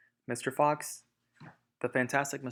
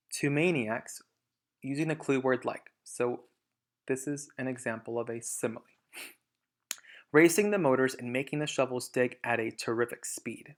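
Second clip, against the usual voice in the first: ordinary delivery, nothing marked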